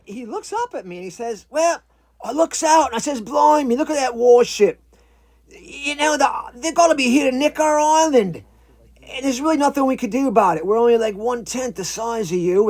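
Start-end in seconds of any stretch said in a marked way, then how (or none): 1.5-12.7 high, loud put-on iguana voice; cockney-style creature yarn, pitched up; talking iguana. Iguana fretting to a visitor about the warship, high put-on voice.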